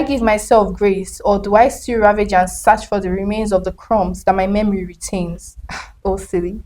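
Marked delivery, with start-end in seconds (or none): none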